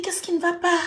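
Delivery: put-on anxious boyfriend asking why she cries; worried boyfriend. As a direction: voice him pressing her anxiously. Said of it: "Il me dit".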